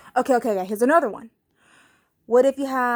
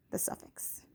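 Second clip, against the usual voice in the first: whispered aside; 20 dB quieter than usual. Real, not acted. Teacher whispering the last words to students. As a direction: drop to a whisper.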